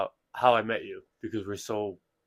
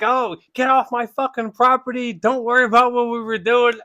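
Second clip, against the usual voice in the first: higher, louder defensive yell; mock angry old man; 10 semitones higher, 6 dB louder than usual. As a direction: shoo her off the porch. Old man yelling at girlfriend.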